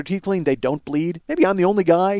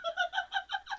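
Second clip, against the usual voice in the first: high squeaky giggle; 19 dB quieter than usual. Real, not acted. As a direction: squeal of laughter pitched way up. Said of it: "She's just like".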